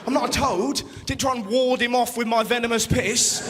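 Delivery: ordinary talk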